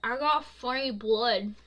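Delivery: slow, loud words round a mouthful; mouth full. A narrator's reading. Bo answering over ice cream, mouth full. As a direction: talk through a bite of food. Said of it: "With his mouth full".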